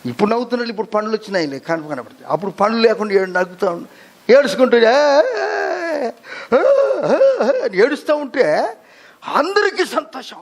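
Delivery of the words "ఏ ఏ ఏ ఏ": newborn wail imitation. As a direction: wail like a newborn just out of the womb. Preacher imitates a newborn crying.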